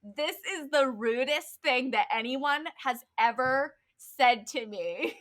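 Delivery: lightly hammed-up outrage; offended outburst. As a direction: imitate someone taking great offense. Retells teacher's offended outburst.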